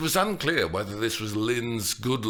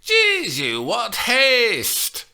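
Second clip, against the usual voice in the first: put-on high, loud old nurse's rebuke; the nurse rebuking Juliet; rebuked; 14 semitones higher, 8 dB louder, much slower than usual. A narrator's reading. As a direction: scold her for her hurry. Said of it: "Lynn rebuked her".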